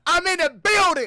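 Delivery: loud, high hype-man yell; hype intro shout. Acted. Streamer hyping his own entrance over sound effects, shouting. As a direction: announce yourself like a ring entrance. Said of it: "There go my sound effects".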